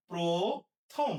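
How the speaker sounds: প্রথম drawn out as pro... thom; pronunciation demo. Shown as a demonstration. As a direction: stretch the o in প্রথম, then a separate thom. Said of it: "উচ্চারণ হবে". (প্রথম টেনে প্রো-থম)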